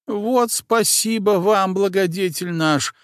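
high, slow, cackling thanks; clucking old woman. A narrator's reading. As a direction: cluck like a fussy old hen. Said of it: "закудахтала Варвара".

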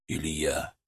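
name barely gets out, voice breaking. Acted. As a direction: voice cracks, soft and low. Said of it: "Его голос надломился". (надломленный голос)

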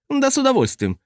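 shrill squeal. A narrator's reading. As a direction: shriek it out high. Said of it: "взвизгнул он".

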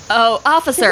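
ordinary talk

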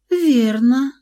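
a touch of surprise, rising; light surprise. Acted. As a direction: say it mildly surprised. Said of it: "В голосе Луизы звучало лёгкое удивление".

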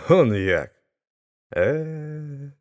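drawn-out, wordless amazement; dumbfounded. A narrator's reading. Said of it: "Більше він нічого не міг сказати".

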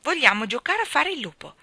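insistent exclamation with lifted pitch; exclaiming. A narrator's reading. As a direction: demand it like eager children. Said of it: "esclamarono insieme".

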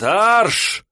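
sharp barked march order; drill command shout. Acted. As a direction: bark it like a parade sergeant. Drill command to step forward.

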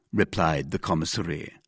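usual speaking voice

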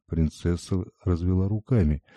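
ordinary delivery

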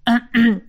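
sheepish throat clearing; embarrassed cough. A narrator's reading. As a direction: cough awkwardly. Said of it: "Дух смущённо кашлянул". (смущённое покашливание)

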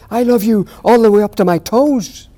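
high, loud gleeful cry; triumphant little hare. Acted. Little hare upside down on the tree, loud gleeful cry. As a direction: crow it upside down with glee. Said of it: "he said".

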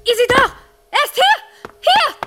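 terrified cries for help; panicked shout. Acted. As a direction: scream for your partner to come. Screaming for help.

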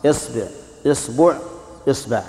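إصبع said with i as the first vowel, the b taking i, then u, then a: isbi', isbu', isba'; three vowel variants. Shown as a demonstration. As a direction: say إصبع with i at the start and run the b through i, u, a. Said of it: "كسرها". (إصبع بكسر الهمزة وتثليث الباء)

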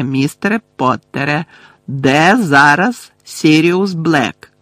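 loud, high, emphatic reproach; threatening interrogator Umbridge. Acted. Voices Umbridge interrogating Harry. (погрозливо, голосно, з притиском)

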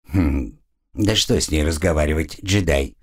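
low contemptuous voice; dismissive. A narrator's reading. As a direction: brush her off with scorn. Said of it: "презрительно отмахнулся".

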